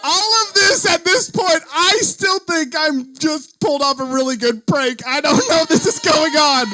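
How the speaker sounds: words squeezed out through loud laughter; can barely get it out for laughing; laughing hard. Real, not acted. Laughing retelling her panic.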